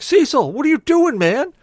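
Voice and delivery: high, loud, appalled brother; incredulous scolding. Retold or imitated. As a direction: call him out in high-pitched disbelief. Brother catches him eating leftovers.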